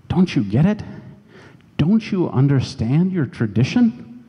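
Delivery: mild imitation of a weeping mentor pressing the point; retold tearful plea. Supervisor crying while pleading with his intern, mildly imitated tearful plea. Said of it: "And still crying".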